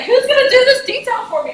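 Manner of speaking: high, loud mock outrage at detail work; exasperated junior. Junior consultant at Deloitte balking at detail work, mock outrage. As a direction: toss the question up loud and high, indignant. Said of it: "'cause I was like".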